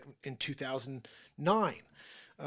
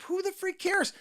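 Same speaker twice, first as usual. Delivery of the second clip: dismissive scoff; 4 dB louder than usual. Retold or imitated. Mock outraged comedian rant.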